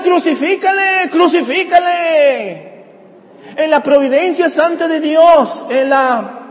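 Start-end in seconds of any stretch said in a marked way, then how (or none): 0.0-2.8 high-pitched mob chant, last call drawn out; crowd shouting. Acted. Preacher voices the crowd turned against Jesus, crowd shouting. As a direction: imitate a mob crying for an execution. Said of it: "ahora están gritando".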